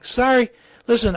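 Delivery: normal talking voice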